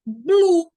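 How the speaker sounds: loud "blue" with B and L together; isolated, emphatic "blue", B-L blended; blended consonant cluster. Shown as a demonstration. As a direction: sound out "blue" as one syllable. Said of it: "Eu não falo bu nem lu".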